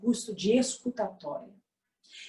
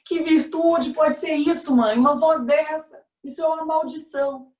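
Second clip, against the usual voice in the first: put-on tearful child; 6 semitones higher, 4 dB louder than usual. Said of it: "E eu chorava".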